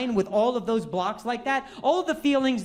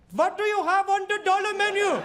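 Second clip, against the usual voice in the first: strained fake Irish accent, pitched up high; bad Irish accent; 9 semitones higher than usual. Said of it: "do an Irish accent".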